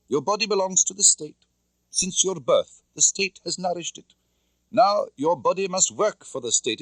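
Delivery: high, cold commanding officer voice; stern Soviet colonel. A narrator's reading. Colonel Klebb briefs Tatiana on her mission, coldly commanding. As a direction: lay out orders like an interrogator.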